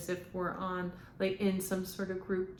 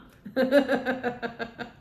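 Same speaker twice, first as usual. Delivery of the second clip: high laugh; 4 semitones higher, 8 dB louder than usual. Retold or imitated. Channeled spirit laughs.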